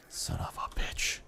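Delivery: slow, whispered curse; breathy, exasperated mutter; frustrated whisper. Real, not acted. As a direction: swear under your breath. Stuck, cursing under breath.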